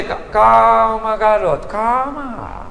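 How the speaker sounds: slow, drawn-out, softening "calma"; telling him to be calm. Acted. Eliseu to the nervous, trembling boy, slow and calming. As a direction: soothe him, stretching the word out low and easy. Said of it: "olhou e falou assim".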